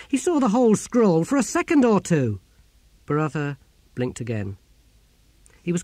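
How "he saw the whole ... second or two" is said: high, louder astonished exclamation; raised, excited wonder; amazed. Acted. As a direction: marvel at it in disbelief. Astonished at the memory feat.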